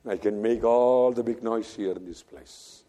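ordinary talk

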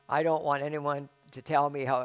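ordinary talk, nothing marked

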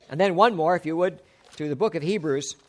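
ordinary talk

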